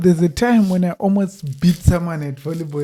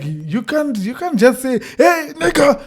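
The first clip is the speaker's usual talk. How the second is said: retold protest; 8 semitones higher, 6 dB louder than usual. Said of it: "because I'm like".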